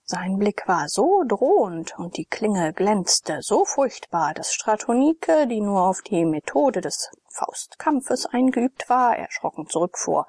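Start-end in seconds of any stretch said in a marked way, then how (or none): none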